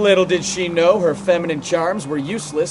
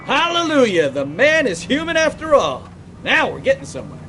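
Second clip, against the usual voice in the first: much higher, gleeful teasing cheer; mock triumphant; 11 semitones higher than usual. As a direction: crow with delight. Partner teasing detective about blondes.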